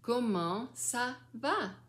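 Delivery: COMMENT ÇA VA slowly, each word lifted; slow clear model. Shown as a demonstration. Grandmother modelling for the child, COMMENT ÇA VA slow and clear.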